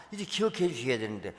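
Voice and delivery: ordinary talk, nothing marked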